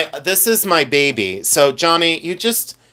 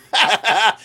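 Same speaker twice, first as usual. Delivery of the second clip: quick, high cackling laugh; burst of laughter; 6 semitones higher than usual. Real, not acted. Seeing me as a boy in a photo, high laughter.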